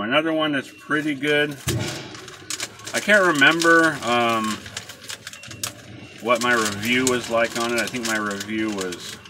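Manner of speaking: talking around pipe stem; pipe in mouth. Real, not acted. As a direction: teeth clenched on pipe. Seen 2: Pipe clenched in teeth throughout; removed at -1.0 and by 10.5.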